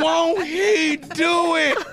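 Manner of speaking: slow laughing shout; shouting catchphrase. Acted. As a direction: belt it out laughing.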